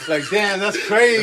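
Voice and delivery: high-pitched words through laughter; laughing. Real, not acted. Cohost reacting to littering story, laughing. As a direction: react while cracking up.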